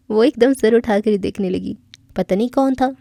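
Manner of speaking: usual speaking voice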